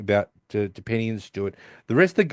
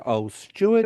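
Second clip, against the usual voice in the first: mock moaning Penny; 8 semitones higher than usual. Retells Penny moaning wrong name.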